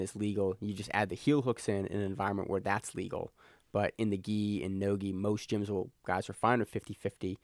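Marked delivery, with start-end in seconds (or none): none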